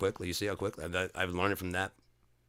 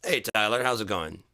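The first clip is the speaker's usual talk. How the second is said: louder, chummy greeting; mock friendly boss; 4 semitones higher, 5 dB louder than usual. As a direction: greet him like an old buddy. Boss greeting the new hire.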